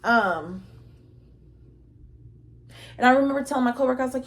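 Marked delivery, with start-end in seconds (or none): none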